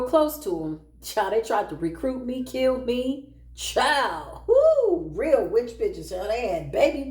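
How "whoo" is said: loud, high whoop of disbelief; loud whoo. Real, not acted. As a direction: let out a big shrill whoo. Exclaiming about the witches.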